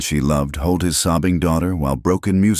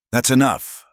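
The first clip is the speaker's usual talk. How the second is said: sharp; 9 semitones higher, 4 dB louder than usual. A narrator's reading. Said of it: "Thomas said sharply".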